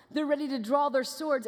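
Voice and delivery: ordinary talk, nothing marked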